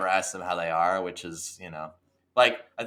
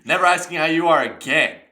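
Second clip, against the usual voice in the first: gloating; 7 semitones higher, 7 dB louder, faster than usual. Pretend. Greedy customer voiced.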